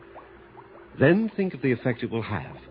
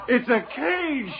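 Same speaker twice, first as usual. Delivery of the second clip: much higher, slow, laughing cry; hysterical realisation; 14 semitones higher, much slower than usual. Acted. The doctor, trapped by his own hand, hysterically high and slow. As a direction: stretch it out through mad laughter.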